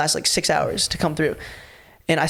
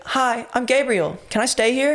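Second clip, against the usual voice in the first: higher, slow, innocent voice; innocent stranger; 7 semitones higher than usual. Retold or imitated. Intruder asks to stay over, innocent higher voice. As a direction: sound small and harmless.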